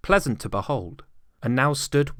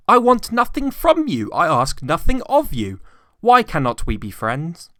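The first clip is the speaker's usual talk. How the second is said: higher, brisk, friendly pleading; pleading nephew; 8 semitones higher, 8 dB louder than usual. A narrator's reading. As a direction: coax the stubborn uncle gently.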